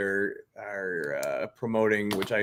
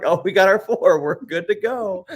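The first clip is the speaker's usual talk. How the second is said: smug players; 9 semitones higher, 6 dB louder than usual. Retold or imitated. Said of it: "They're like".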